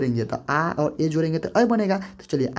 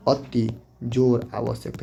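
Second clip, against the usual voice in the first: slow dictation; 4 semitones lower, slower than usual. Shown as a demonstration. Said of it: "थोड़ा ध्यान दीजिएगा".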